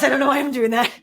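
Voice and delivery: amused by your own silly voice; laughing at herself. Real, not acted. Laughing at her own silly impression.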